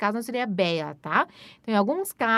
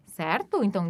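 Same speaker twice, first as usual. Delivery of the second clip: usual speaking voice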